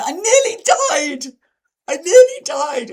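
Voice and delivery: high, loud mock shriek; slow, shouted, hammed-up hysteria; really dramatic friend. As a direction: imitate a drama queen wailing at full volume. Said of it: "a friend who's really dramatic".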